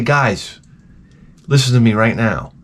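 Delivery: ordinary talk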